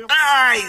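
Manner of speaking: drawn-out cry of sympathetic pain; high wincing "ouch". Acted. Game voice watching player crash, pained yelp. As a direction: wince aloud at the crash, high.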